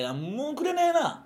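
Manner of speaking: drawn-out, high-pitched gripe; comic exasperated wail; mock indignant complaint. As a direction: stretch out the grievance, pitch soaring. Comic rant demanding work from others.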